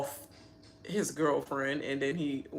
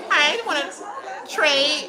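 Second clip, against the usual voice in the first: hammed-up attitude, high and loud; sassy mock Taylor; 10 semitones higher, 7 dB louder than usual. Vlogger imitates Taylor refusing the trade, sassy and loud. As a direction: put some sass on it, pitched up. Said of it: "She was like".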